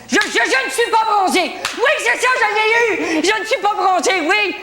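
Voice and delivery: high, stammering excited outburst; excited breakthrough. Acted. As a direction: burst out high, thrilled to have got it. Triumphant solving of a guessing game. (excité, tout aigu)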